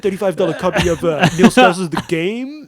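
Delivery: loud, high prank-call voice; mock prank caller. Prank caller asking rival for his book, loud put-on voice.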